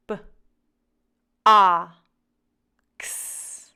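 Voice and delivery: B, A, X as separate sounds, pauses between; short B, loud open vowel for A, ks for X; sounding out. Shown as a demonstration. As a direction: say B, A, X as sounds, not names. Sounding out a word to blend.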